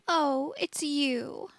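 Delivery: lightly voiced mopey ghost girl, slow; a hint of a sniffly, sulking ghost; sulky ghost girl. A narrator's reading. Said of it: "She sniffed".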